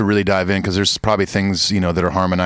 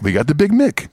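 higher put-on voice on Big Mick; Coming to America impression; 7 semitones higher than usual. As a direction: mimic the copycat owner, raised and theatrical. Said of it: "a little more Coming to America".

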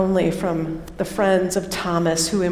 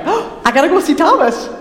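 high, loud, racing excitement; breathless rush of joy, pitched way up; excited Jesus; 7 semitones higher, 4 dB louder, faster than usual. Acted. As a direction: burst with news you can't wait to share. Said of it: "Does it sound a lot more like Jesus".

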